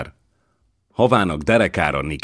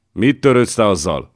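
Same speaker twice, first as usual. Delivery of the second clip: higher, louder outburst; snorting retort; 6 semitones higher, 6 dB louder than usual. A narrator's reading. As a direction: snap it back with a loud snort of anger. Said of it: "horkant fel Csorba". (felhorkanva, hangosabban)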